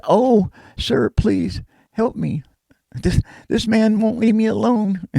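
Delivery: high pleading woman's voice; frightened woman. Retold or imitated. Woman at the door begging a stranger, high and pleading.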